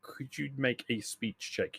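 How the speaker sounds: usual speaking voice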